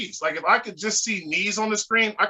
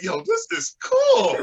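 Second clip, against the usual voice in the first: impressed; 13 semitones higher, 3 dB louder, slower than usual. Retold or imitated. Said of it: "I was just listening like".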